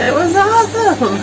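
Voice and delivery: high, loud, thrilled burst; excited. Real, not acted. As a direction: gush about it loudly. Excited recap after tour.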